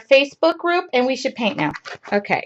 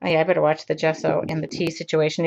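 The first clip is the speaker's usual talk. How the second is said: ordinary talk, nothing marked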